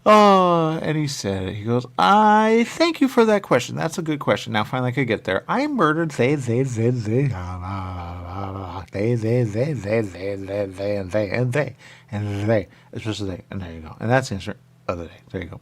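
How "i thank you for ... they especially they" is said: cartoon murderer rambling; hammed-up killer. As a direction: list the victims like a proud villain. Voicing the murderer confessing.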